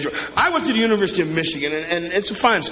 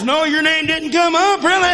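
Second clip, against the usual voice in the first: wife's comeback; 7 semitones higher than usual. Retold or imitated. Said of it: "She says".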